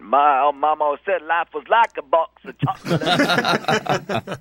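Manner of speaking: slow simple Southern Gump impression; Forrest Gump voice. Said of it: "you could see him saying".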